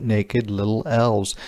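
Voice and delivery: ordinary delivery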